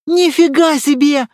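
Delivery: high, loud astonished cry; loud wow of disbelief; amazed exclamation. A narrator's reading. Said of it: "воскликнул Добродеев".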